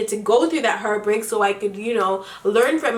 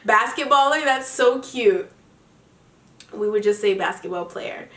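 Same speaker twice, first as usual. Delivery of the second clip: ordinary talk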